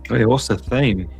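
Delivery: ordinary delivery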